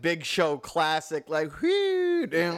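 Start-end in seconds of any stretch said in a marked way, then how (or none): 1.3-2.3 whooping explosion noise. Retold or imitated. Imitating a big explosion.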